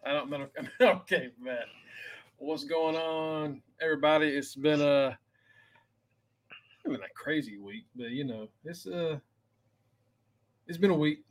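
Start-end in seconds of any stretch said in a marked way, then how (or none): none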